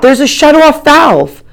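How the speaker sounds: high, loud, slow shout; shouted "shut off valve", loud and slow. A narrator's reading. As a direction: yell "shut off valve" over the water. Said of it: "I shout".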